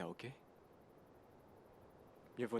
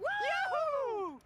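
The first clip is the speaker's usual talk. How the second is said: much higher joyous whoop; excited whoop; 13 semitones higher, 3 dB louder than usual. Acted. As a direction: cheer the snipers loudly. Cheering sniper rescue.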